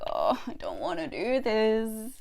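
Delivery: mock weary whine; tired reluctance. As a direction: drag it out like someone too tired to bother. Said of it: "where you're just tired".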